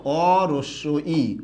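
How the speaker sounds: অ রস্য ই stretched very slowly, each part apart; teacher breaks the vowel into parts; slow spelled-out demo. Shown as a demonstration. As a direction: spell it out piece by piece. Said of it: "আমরা এরকম উচ্চারণ করি".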